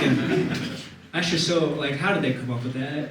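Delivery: ordinary delivery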